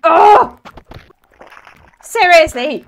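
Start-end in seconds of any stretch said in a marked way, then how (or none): 0.1-0.6 loud high yelp; startled cry. Real, not acted. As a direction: shriek in alarm. Sudden danger in game.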